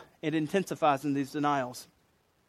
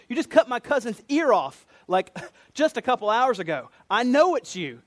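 higher, louder, incredulous accusation; indignant accusation; 6 semitones higher, 4 dB louder than usual. Retold or imitated. Relative of Malchus confronting Peter, indignant accusation. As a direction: act out an outraged relative pointing the blame.

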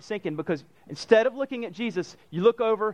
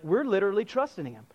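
ordinary talk, nothing marked

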